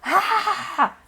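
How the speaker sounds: short high, loud scream; sudden high-pitched yell; demonstrated scream. Shown as a demonstration. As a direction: let out a loud "Ah!" of release. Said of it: "Você já fez?".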